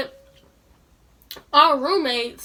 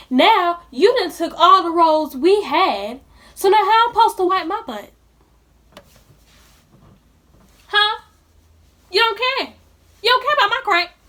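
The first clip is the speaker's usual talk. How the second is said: raised, higher pitched complaint at the roommates; exasperated rant; 5 semitones higher, 4 dB louder than usual. Real, not acted. Ranting at roommates over missing toilet paper, exasperated. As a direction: demand an answer, fed up.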